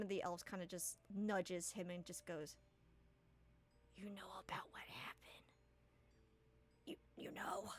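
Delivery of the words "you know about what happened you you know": secretive hushed whisper. Acted. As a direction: lean in and whisper it. Elf nudges, secret aside.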